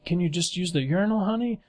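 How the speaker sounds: put-on high, coaxing dad to his little girl. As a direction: gently talk the kid into it. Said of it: "he's like".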